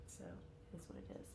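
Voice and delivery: soft breathy whisper. Real, not acted. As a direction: whisper it under your breath. Whispered asides.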